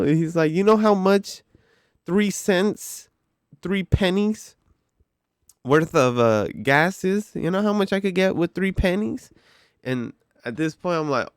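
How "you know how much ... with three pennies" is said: high-pitched, worked-up mock demand; indignant customer. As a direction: imitate a customer outraged over small change. Acted angry customer's accusation.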